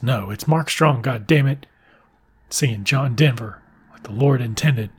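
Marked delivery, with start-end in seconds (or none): none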